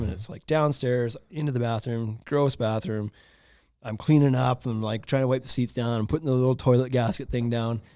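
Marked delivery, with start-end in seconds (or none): none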